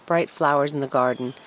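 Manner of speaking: ordinary delivery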